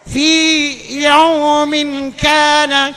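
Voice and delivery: very slow, loud chanted Arabic; Quranic recitation. Acted. Preacher reciting the verse to the congregation, slow chant. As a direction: chant it like a qari, stretched.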